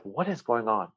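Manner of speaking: soft, puzzled muttering to oneself; hushed bewilderment. Retold or imitated. Acting out dog owner lying in bed, hushed bewilderment. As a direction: drop the volume as if thinking aloud.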